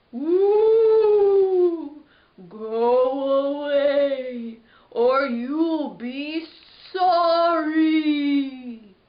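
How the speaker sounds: loud, drawn-out, sing-song ghost moan; ghostly moan. Acted. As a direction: moan it slowly like a haunting mummy. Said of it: "the same voice began to moan".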